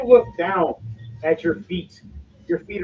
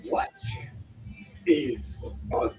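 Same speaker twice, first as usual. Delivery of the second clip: slow, hushed dragon with long pauses; dragon-esque voice; 6 dB quieter, slower than usual. Acted. Dragon answers the intruders from the dark, dragon-esque. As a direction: let each word hang like a puzzled dragon. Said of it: "some kind of dragon-esque voice".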